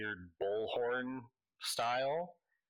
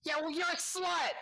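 very high, petulant retort; squeaky gnome comeback; 3 dB louder than usual. Acted. Gnome firing back an insult, very high petulant retort. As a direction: snap back like a bratty kid.

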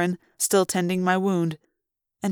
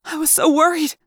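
worried woman; 9 semitones higher than usual. A narrator's reading. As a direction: let the worry spill out. Lauren voiced, relieved.

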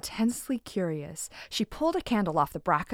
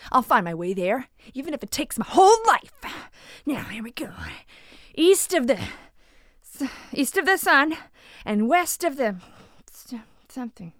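put-on higher, louder determined girl; eager heroine; 4 semitones higher, 3 dB louder than usual. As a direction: set off bravely, then fumble. Gita sets off, forgets words.

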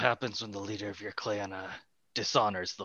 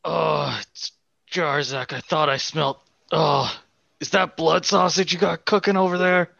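loud, higher in-character hail; hearty calling out; 7 semitones higher, 6 dB louder than usual. Acted. Jarzak hails the orcs over their cooking, calling out loudly. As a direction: call across the camp, pitch raised.

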